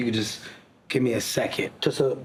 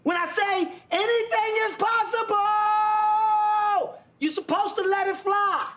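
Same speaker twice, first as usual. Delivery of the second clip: mock scolding. Host telling crew when to let it fly, high mock outrage. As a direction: holler it high like a fed-up boss.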